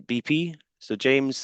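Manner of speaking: usual speaking voice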